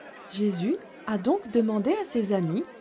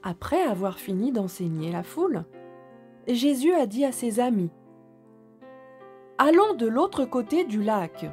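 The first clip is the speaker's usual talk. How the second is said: soft storytelling voice; 4 dB quieter than usual. A narrator's reading. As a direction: read gently to little children. Story narration.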